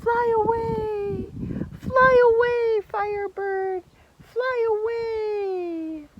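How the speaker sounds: a lightly voiced prince setting the bird free; tender. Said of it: "he whispered".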